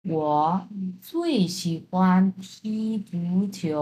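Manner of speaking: slow, careful Chinese answer; slow model reply. Shown as a demonstration. Student answering a friend slowly in Chinese. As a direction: say each syllable slowly and clearly. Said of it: "আমি এখন, আম, answer করছি".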